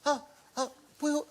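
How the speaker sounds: put-on high, halting child voice; imitated sweet children's appeal; adorable kids. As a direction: plead like a small child. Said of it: "his kids sent the most adorable video".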